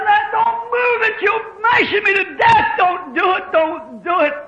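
high, loud retold cries; desperate high-pitched begging; pained pleading. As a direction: act out a crushed boy screaming to stop. Crushed boy's screams retold.

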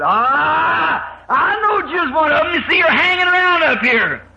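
high, loud mocking call; jeering mocker. Mocker jeers at waiting Noah.

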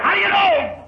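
high, insistent demand; doubting challenge. Acted.

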